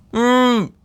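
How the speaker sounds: high, muffled call from under snow; muffled hum. Acted. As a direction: hum as if buried. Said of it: "came a muffled sound".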